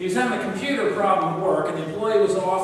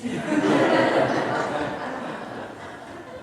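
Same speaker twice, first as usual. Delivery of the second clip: laugh; 4 semitones higher than usual. Real, not acted. Preacher laughs at own joke.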